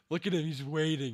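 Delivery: eager hype at the screen; rising excitement. Real, not acted. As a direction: lean in, getting hyped.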